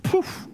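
puffed-out whoosh of air; poof sound. Retold or imitated. Pastor showing anxiety leaving him, puffed poof. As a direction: blow out a quick "poof".

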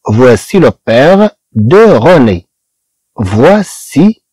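each word of "voici le père de René" stretched out slowly; slow French model sentence. Shown as a demonstration. Teacher reading the French example slowly to students. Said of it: "مثلًا".

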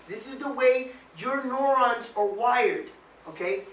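normal talking voice

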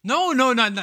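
loud, high-pitched no no no to the viewers; loud protest. Real, not acted. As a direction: cut them off with a loud, rising protest. Protesting to chat.

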